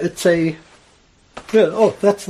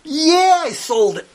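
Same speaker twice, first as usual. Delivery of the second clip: yelling back; 11 semitones higher, slower than usual. Retold or imitated. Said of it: "Guy yells back".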